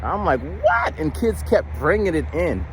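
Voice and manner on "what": high squeaked what?; incredulous what. Retold or imitated. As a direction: shoot the pitch way up in disbelief. Shocked at junk-food table.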